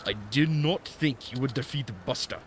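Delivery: hammed-up mock-Japanese old master; Temu Japanese accent. Old master greeting the arena winner, bad Japanese accent. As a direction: greet them in a badly faked Japanese accent. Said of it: "doing some Temu Japanese accent".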